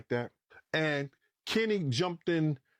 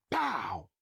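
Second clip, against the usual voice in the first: high, falling "pow"; imitated gunshot; 6 semitones higher than usual. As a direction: mimic a single shot going off. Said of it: "you hear it say".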